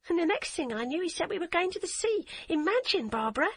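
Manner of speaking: put-on high, bubbly voice of a happy eight-year-old; a child who can't stop repeating her news; excited little girl. A narrator's reading. Child voice of Hadia.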